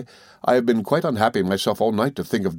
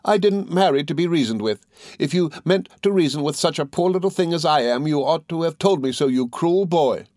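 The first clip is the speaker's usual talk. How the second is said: put-on high, aggrieved young wife; despairing protest; 7 semitones higher than usual. A narrator's reading. Dora reproaching her husband for reasoning, in despair. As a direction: pour out hurt reproach in a raised pitch. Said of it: "exclaimed Dora in despair".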